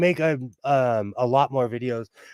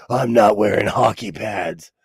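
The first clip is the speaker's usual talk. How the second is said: Batman voice; 6 semitones lower than usual. Pretend. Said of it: "That's more my Batman voice".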